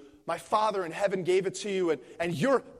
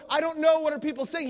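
mock clueless narcissist; 7 semitones higher, 5 dB louder than usual. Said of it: "to go like".

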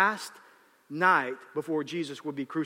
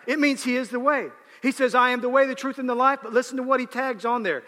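full-force preaching; 6 semitones higher, 5 dB louder than usual. Acted.

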